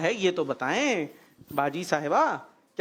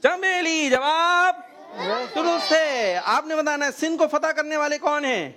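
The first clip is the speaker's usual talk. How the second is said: high, ringing call of the verdict; quiz show announcer; 6 semitones higher than usual. Real, not acted. Host confirming a child's answer, announcer style. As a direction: announce it big to the audience.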